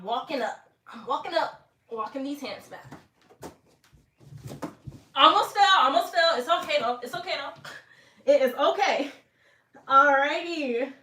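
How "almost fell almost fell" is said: louder, higher startled gasp; startled alarm. Real, not acted. Slipping on the wall, startled alarm to viewers. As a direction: blurt it out as you slip. Slips during handstand.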